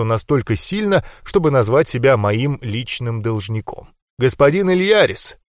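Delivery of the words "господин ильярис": mildly weary formal hello; understated tiredness, pitched higher than narration; tired greeting. A narrator's reading. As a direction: greet formally with a touch of fatigue. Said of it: "устало поздоровался со мной Вязий".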